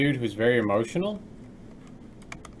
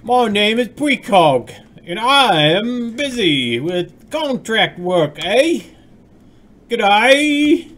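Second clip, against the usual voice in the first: loud, high, drawn-out bad British accent; Precog in fake accent; 10 semitones higher, 10 dB louder, much slower than usual. Streamer mimicking a busy friend for chat, hammy fake accent. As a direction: stretch the words in a hammed-up, badly faked British accent. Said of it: "Can I do a British accent".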